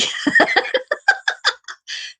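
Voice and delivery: high airy giggle; a breathless, delighted little laugh. Real, not acted. Laughing at YouTube chats.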